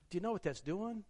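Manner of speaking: ordinary delivery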